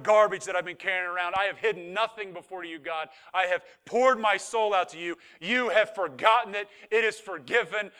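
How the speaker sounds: loud, building preacher's prayer; forceful, insistent claim of forgiveness; impassioned declaration. Acted. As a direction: proclaim it to God with full conviction.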